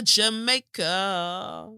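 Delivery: Jamaica very slow with a rising end; Jamaica stretched out. Shown as a demonstration. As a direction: drawl "Jamaica" dreamily.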